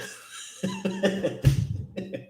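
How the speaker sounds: high, wild cackling laugh; burst of laughter. Real, not acted. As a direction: crack up at your own joke. Laughing at own joke on stream.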